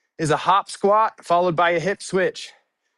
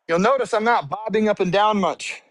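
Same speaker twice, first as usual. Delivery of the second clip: ordinary talk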